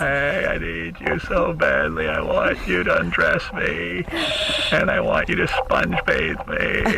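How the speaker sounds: slow, breathy pleading invalid; Christopher Reeves voice. Pretend. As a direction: ham up a needy patient, slowly. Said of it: "this is Christopher Reeves".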